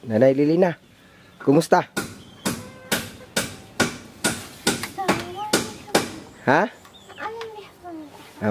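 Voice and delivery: speaking up so the camera hears. Real, not acted. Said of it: "Nilakasan ko po yung busis ko". (nilakasan ang boses para marinig)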